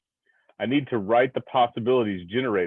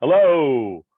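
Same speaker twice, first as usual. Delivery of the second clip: a loud, higher shout of hello; loud call; 5 semitones higher, 9 dB louder than usual. Pretend. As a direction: call out hard into the cavern. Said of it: "I call out again".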